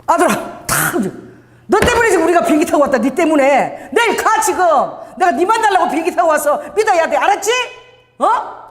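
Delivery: grab him with total certainty; bold preaching urge. Retold or imitated. Bold evangelist urging a stranger.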